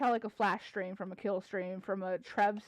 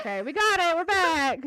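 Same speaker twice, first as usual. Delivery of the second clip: high, loud triumphant announcement; excited relief; 7 semitones higher, 7 dB louder than usual. Real, not acted. Streamer telling viewers the audio is back, excited cheer. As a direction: cheer that the problem is over. Audio returns after dropout.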